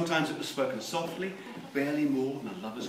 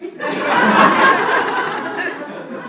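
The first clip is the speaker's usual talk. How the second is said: laugh; 15 dB louder than usual. Real, not acted. Audience laughing.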